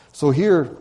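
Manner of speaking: ordinary talk, nothing marked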